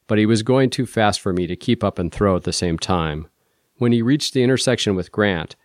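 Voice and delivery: ordinary delivery, nothing marked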